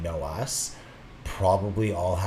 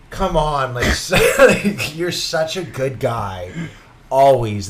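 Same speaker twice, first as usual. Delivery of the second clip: exasperated groan; 5 semitones higher, 9 dB louder, slower than usual. Retold or imitated. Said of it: "you're like".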